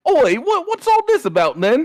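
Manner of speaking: mock British bobby, oi what's all this; British accent. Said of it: "he's being British?".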